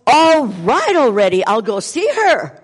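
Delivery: loud fed-up surrender; exasperated giving in. Retold or imitated. Answering the nagging voice, loudly exasperated. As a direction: throw up your hands and give in out loud. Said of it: "I said to that voice".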